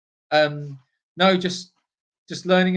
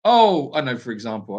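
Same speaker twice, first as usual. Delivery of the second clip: ordinary delivery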